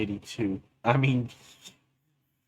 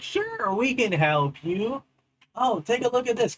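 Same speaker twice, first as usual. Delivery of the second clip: oily villain luring them in, pitched up high; sly Deceiver; 11 semitones higher than usual. Acted. The Deceiver offers help.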